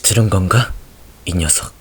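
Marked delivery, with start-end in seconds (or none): 1.3-1.7 tender murmur. Acted. Tender to dead soldier.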